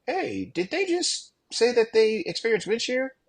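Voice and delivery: high, surprised radio query; asking in disbelief, voice jumping up; surprised pilots. Retold or imitated. Southwest pilots checking the radio, surprised and high. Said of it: "they're like".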